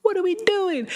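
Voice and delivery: high, laughing incredulous question; laughing disbelief. Retold or imitated. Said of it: "I was like".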